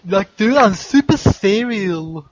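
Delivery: loud, high, drawn-out mock earnestness; Al Gore. Friend doing a quote for a guessing joke, impression. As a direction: push it up and stretch it, comically serious. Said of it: "Get it? Al Gore?".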